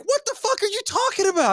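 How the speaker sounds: very high incredulous dad impression; hammed-up baffled retort; confused dad. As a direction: fire back in mock bewilderment. Voices confused dad arguing back.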